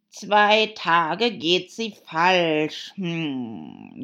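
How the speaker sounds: loud, drawn-out lament; sighing. Acted. Hatter finding his watch wrong, slow sighing complaint. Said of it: "seufzte der Hutmacher".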